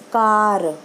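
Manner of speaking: "कार" with the final र fully sounded; Punjabi pronunciation. Shown as a demonstration. Teacher shows students the Punjabi habit, "कार" with sounded र. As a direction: say "कार" the Punjabi way, ending on a clear र. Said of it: "हम पंजाबी में बोलेंगे".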